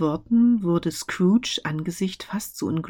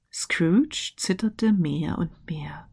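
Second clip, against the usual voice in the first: ordinary delivery, nothing marked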